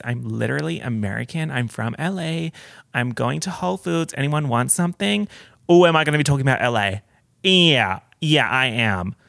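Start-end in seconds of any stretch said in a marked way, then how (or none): none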